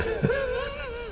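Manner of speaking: quick high-pitched giggle; high laugh. Real, not acted. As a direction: giggle mid-sentence. Laughing at donut story.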